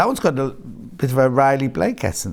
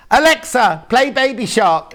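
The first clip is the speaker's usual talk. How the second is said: calling to Alexa; 8 semitones higher, 5 dB louder, slower than usual. Retold or imitated. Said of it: "and I go".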